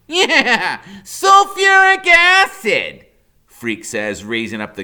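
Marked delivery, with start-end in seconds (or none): 0.1-3.1 high, loud, drawn-out with a laugh; gleeful kid boast. A narrator's reading. Freak bluffing Killer Kane, gleeful and hammy. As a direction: relish the word like a mad scientist.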